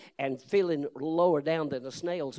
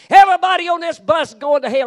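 even louder, much higher all-out hellfire shout; full-blast bus preacher yell; 12 semitones higher, 16 dB louder than usual. Retold or imitated. Street preacher roaring at a packed bus, louder and higher. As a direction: give it everything, a roaring warning. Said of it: "He got up on a bus and said".

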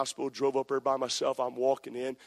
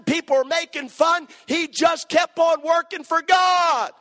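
high, loud, driving pulpit preaching; full-force preaching; 11 semitones higher, 9 dB louder than usual. Acted. Preacher warning his church about pride, full-force preaching. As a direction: preach it hard to the congregation.

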